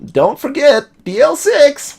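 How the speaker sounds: very high, loud parrot squawking the words. Acted. The parrot Polly squawks its warning at the visitors, high and loud. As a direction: squawk it out like a talking parrot, the last words slow.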